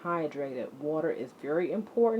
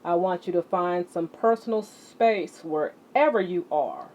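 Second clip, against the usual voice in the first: ordinary talk, nothing marked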